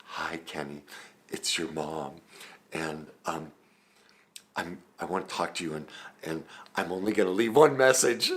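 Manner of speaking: passive-aggressive mom. Retold or imitated. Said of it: "still totally passive aggressive".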